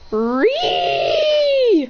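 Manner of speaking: very high, loud shriek. Acted. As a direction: scream as the punch lands. Hit by the punch.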